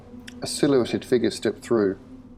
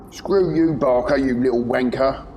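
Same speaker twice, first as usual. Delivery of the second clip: loud, higher insult; angry; 4 semitones higher, 4 dB louder than usual. Acted. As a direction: snap back in anger. Prisoner insults Barker.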